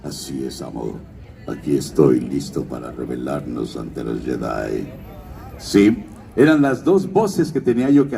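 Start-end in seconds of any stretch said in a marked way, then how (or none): 0.0-4.9 deep, low devoted apprentice villain; Darth Maul voice. Retold or imitated. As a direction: growl it low like a Sith. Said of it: "luego Darth Maul decía".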